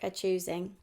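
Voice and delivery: ordinary delivery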